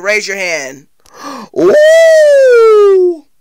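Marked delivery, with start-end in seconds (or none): 1.6-3.3 gasp, then a loud high "ooh"; impressed, soaring ooh; high drawn-out ooh. Pretend. Reacting to raised hands.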